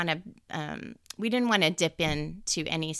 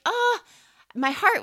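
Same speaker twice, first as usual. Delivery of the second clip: joyful gasp; 13 semitones higher, 7 dB louder than usual. Real, not acted. Overwhelmed with joy.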